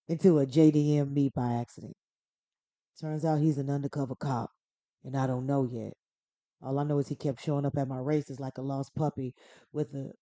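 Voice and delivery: best attempt at a tough-guy movie voice; Dominic Toretto. Podcaster reading a plot summary as street racer, tough-guy impression. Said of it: "I'm gonna try my best".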